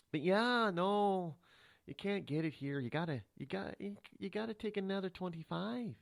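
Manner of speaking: sing-song Minnesota official; Minnesota accent. Acted. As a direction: lilt it with broad Minnesota vowels. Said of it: "I'm gonna use my Minnesota voice".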